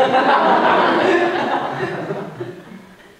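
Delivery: long, loud, high laugh; helpless laughter. Real, not acted. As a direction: laugh it out, can't stop. Listener laughs at confession.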